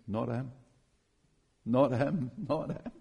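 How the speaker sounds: soft, low-key retold inner voice; inner voice of God. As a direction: quietly voice God's inward refusal. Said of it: "this inner voice of God".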